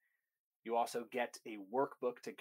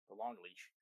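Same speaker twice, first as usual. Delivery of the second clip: under breath; 5 semitones lower, 7 dB quieter than usual. Real, not acted.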